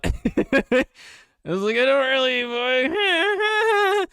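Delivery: words squeezed high through laughter; cracking up mid-sentence, pitch shooting up; real laughing. Real, not acted. Laughing at her own private thought about her sister, high giggly voice.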